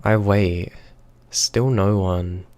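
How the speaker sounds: usual speaking voice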